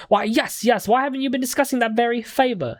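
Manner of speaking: eccentric game defendant. Acted. Client haggling with two students over payment, pompous and high. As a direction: put on an affected London accent. Voices defendant offering money.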